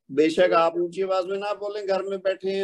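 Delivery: ordinary delivery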